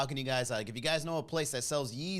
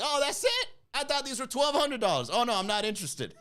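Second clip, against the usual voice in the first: snooty dismissal; 8 semitones higher than usual. Retold or imitated. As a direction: act unimpressed by the price. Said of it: "I checked her. I was like".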